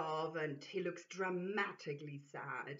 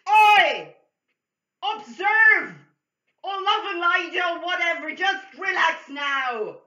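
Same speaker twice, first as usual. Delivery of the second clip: much higher, much louder scream; 10 semitones higher, 19 dB louder than usual. Acted. Distraction screams at the lady to relax, furious. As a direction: yell the order at her.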